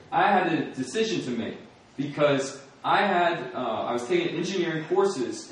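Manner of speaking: ordinary talk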